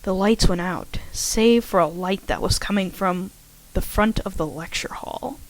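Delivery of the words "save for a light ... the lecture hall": plain reading voice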